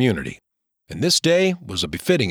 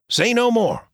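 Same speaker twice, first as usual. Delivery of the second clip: higher, cheerful friend's voice; James, friendly; 10 semitones higher than usual. Acted. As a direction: voice James brightly cutting him off.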